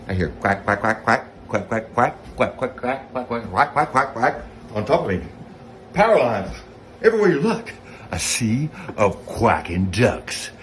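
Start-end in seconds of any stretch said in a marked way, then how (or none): none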